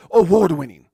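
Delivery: booming announcer call. Shown as a demonstration. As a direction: shout it out loud, hype announcer. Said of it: "I should start saying that".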